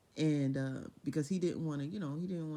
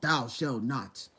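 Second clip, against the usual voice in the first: slow, lower, solemn mock decree; stuffy biblical God; 6 semitones lower, 5 dB louder, much slower than usual. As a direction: intone like an old stern deity. Parodying a stuffy sky God.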